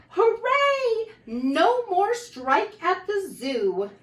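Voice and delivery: high, loud hooray, then slow; zookeeper's cheer. Acted. Zookeeper cheering the news to reporters, high and loud. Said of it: "The zookeeper calls the reporters to say".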